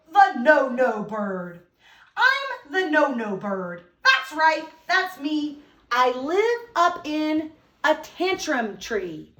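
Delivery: put-on slow, expressive read-aloud for kids; reading a poem to children. Shown as a demonstration. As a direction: read it slowly and with feeling to the class. Teacher reads the poem aloud.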